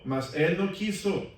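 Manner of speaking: normal talking voice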